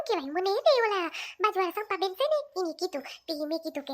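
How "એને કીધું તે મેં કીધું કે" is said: ordinary talk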